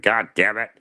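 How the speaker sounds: mock exasperated cry. Retelling his reaction to the dogs' mess, exasperated.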